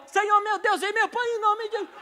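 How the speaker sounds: high, loud mock prayer; praying in D major. Preacher mimicking his own high-pitched prayer. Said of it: "e eu orando em ré maior".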